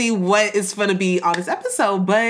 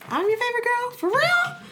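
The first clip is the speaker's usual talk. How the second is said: playful squeal, much higher; squeaky high voice. Acted.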